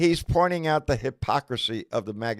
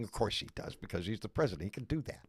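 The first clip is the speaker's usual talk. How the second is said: sarcastic hush; 5 semitones lower, 12 dB quieter than usual. Real, not acted. Mocking the Biden-prices claim.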